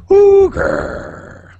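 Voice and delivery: high, loud hoot then mean growl; friendly hoot, then snarl the gur; owlbear impression. Shown as a demonstration. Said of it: "Starts out friendly, ends kinda mean".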